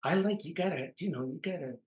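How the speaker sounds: high, emphatic imitation of the manager; Harry Colombi's way of talking. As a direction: mimic his pushy way of talking. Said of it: "he had this kind of a thing about talking like this".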